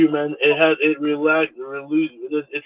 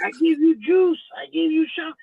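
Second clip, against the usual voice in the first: high, thin imitation of an old Thai lady; mimicking old lady; 11 semitones higher than usual. Mimicking the old lady who sold him drinks. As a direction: squeak it out like a grandma haggling. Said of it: "she's like".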